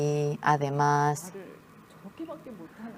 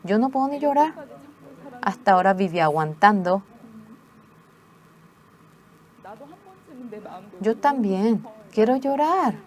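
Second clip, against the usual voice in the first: anguished plea; 5 semitones higher than usual. Retold or imitated. Daughter's anguished outburst.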